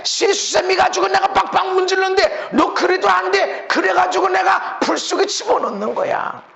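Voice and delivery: shouting, fed up with scrubbing; loud, frustrated scolding of dirty metal; exasperated shout. Acted. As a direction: yell it like a refiner losing patience. God scrubbing the stubborn silver.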